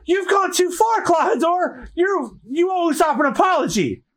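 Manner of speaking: very high-pitched scolding; high, outraged character voice; indignant mistress. Acted. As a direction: rebuke the butler, pitch way up. Mistress scolds her butler.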